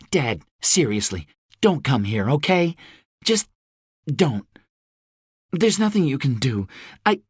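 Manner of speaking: a lightly voiced daughter, anxious; Rose. A narrator's reading. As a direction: Rose telling her father the situation is grim.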